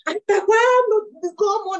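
laughing, slow and high in frustration; high drawn-out laughing exasperation; exasperated laugh. Real, not acted. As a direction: laugh out your fed-up exasperation. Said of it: "me dan ganas de mandarlo a la cresta".

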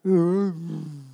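low, droopy groan; hammed-up joyless drone. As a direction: jaw on the floor, mock misery. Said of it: "need a forklift to pull their bottom jaw up".

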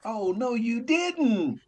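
mock disbelief. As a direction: stretch it out in playful disbelief. Mock reaction to a woman falling.

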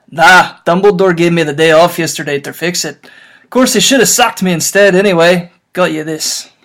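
loud, booming half-giant voice; loud rough gamekeeper voice; Hagrid voice. Acted. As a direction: boom it out like a big-hearted giant in tears. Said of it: "is this me again".

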